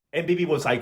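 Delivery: ordinary delivery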